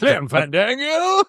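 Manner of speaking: high, drawn-out theatrical catchphrase, rising at the end; Clem Fandango catchphrase. Retold or imitated. Quoting the actor's catchphrase to friends, hammed-up and drawn out. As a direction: stretch it out in a put-on actor's voice. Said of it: "He's just said that".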